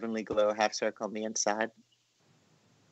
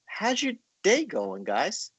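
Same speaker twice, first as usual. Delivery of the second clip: much higher, slow, sung-out hello to viewers; sing-song greeting; 10 semitones higher, slower than usual. Real, not acted. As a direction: stretch the greeting out playfully. Friendly greeting to viewers mid-drawing.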